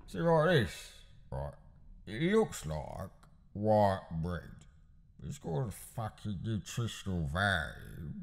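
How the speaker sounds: Cockney gangster, blunt and sure; Cockney voice pushing the brown bread; Alfie Solomons impression. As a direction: lecture him on bread like a threat. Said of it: "the iconic brown bread scene".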